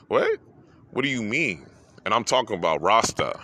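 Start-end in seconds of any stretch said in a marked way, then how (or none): 0.1-1.7 shocked disbelief. Retold or imitated. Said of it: "I got choked up and I'm like".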